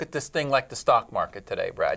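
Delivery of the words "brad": ordinary delivery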